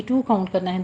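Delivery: ordinary talk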